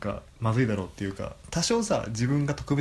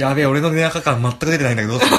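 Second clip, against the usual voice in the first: laughing while talking; loud laughing dismay; 3 semitones higher, 10 dB louder than usual. Real, not acted.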